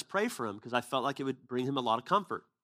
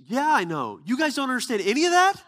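much higher and louder, laughing through it; laughing disbelief; 11 semitones higher, 9 dB louder than usual. Retold or imitated. Adam amazed his praying friends can't understand, laughing. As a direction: laugh out the question, amazed they don't know.

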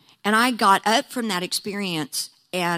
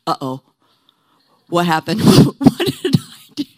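Peter's laughing panic, louder at the end; panicked Peter; 3 semitones lower, 8 dB louder than usual. Retold or imitated. Peter panics after the Gentiles receive tongues, laughing panic. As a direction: blurt it out like you've just broken the rules. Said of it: "And he's like".

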